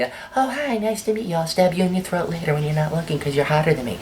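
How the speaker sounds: sweet on top, poison underneath; a girl greeting a rival with fake sweetness; catty girl. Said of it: "Girls always be".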